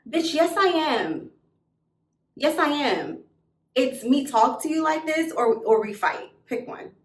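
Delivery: high, emphatic pushback; defiant comeback. Retold or imitated. Betrayed girl challenges best friend, defiant. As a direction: stand your ground, spoiling for a fight.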